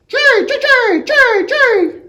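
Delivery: very high, loud screeching call; shrieking bird call; blue jay screech. Acted. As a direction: screech it sharp as a drill. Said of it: "screech as sharp as a drill".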